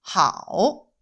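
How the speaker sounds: 하오 with a dipping tone; 하오 said as hao, falling then rising; Mandarin tone. Shown as a demonstration. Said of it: "중국어 발음 구조". (성조 있는 하오)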